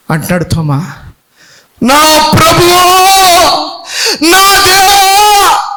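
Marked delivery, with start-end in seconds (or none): 1.8-5.7 high, loud, drawn-out cry; Thomas's confession. Acted. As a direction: stretch out Deva in awe.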